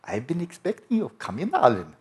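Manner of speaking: put-on playful German-accented welcome. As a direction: greet him the way Einstein would, in a German accent. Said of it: "He would speak in a German accent".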